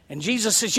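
ordinary talk, nothing marked

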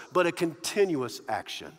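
normal talking voice

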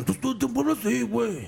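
high-pitched mock norteño Mexican accent. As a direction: imitate an actor's put-on northern Mexican accent. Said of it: "acento de norteño mexicano".

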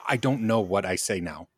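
normal talking voice

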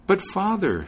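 put-on high, pleading young woman; pleading daughter. A narrator's reading. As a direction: beg him, voice lifted high. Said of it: "pleaded as hard as she could".

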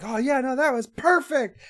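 high, bright burst of glee; super excited. Retold or imitated. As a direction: gush like a thrilled frontman. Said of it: "he's super excited".

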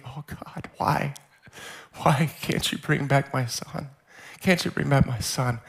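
hushed, breaking into a whispered prayer, reenacted; tearful plea. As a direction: plead softly with God through imitated tears. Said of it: "I just start crying".

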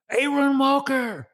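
high, slow call of his name, like spotting a celebrity; excited fan. Retold or imitated. Stranger recognizing him in the parking lot, high excited call. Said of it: "and he goes".

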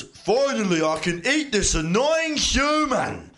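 high, loud, slow gloating; greedy monster. Acted. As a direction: savor the threat like a hungry beast. Hungry troll head speaks.

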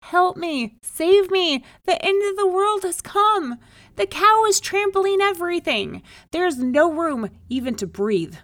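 high, loud panicked pleading; frantic shriek. A narrator's reading. Man begging the rabbi to save him, frantic shriek. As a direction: shriek as if the world is ending. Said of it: "he shrieked".

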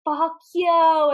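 mock-yell it, drawn out and defiant; hammed-up shout at a monster; Eddie. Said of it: "it was Eddie yelling at it".

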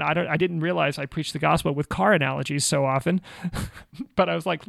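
ordinary talk

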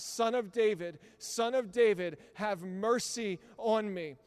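loud, desperate cry for mercy; a beggar calls out to Jesus passing by, loud. Retold or imitated. Beggar shouting at Jesus from the roadside, loud and pleading. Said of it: "screaming out".

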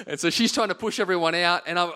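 ordinary delivery

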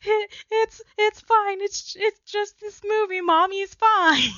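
a hammed-up weepy mom reassuring her kids; mock sobbing reassurance. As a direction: act out crying while insisting you're okay. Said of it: "sobbing and just like".

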